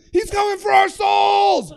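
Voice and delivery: high, loud, drawn-out shriek; terrified scream. Retold or imitated. Tripping friends fleeing stranger at vending machine, terrified scream. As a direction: scream a warning in mock terror.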